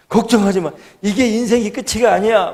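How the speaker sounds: high, loud voice of a helper; imitating a driver rallying someone; emphatic reassurance. Truck driver to the crying youth, firmly reassuring.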